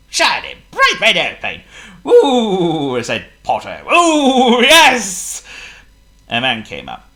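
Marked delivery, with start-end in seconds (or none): 2.1-3.0 admiring ooh. Acted. Potto admires plane. 3.9-5.4 high, loud eager ooh; delighted. Acted. Potto admires plane.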